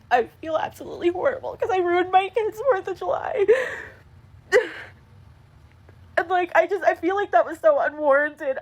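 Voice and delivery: real upset, words pushed out through tears; crying. Real, not acted. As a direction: voice high and tearful, on the verge of sobbing. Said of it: "I'm, like, shaking right now".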